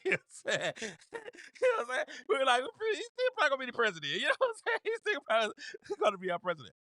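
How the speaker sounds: high-pitched, laughing through the words. Real, not acted. Cracking up telling the crowd Trump still wins, laughing. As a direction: barely get it out for laughing. Cracks up over his own prediction.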